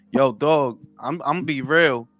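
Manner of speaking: usual speaking voice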